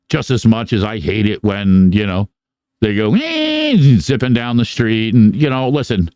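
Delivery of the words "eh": mock dirt bike whine. Imitating dirt bikes zipping past.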